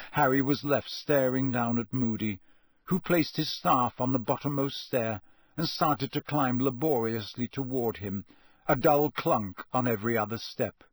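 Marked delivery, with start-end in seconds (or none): none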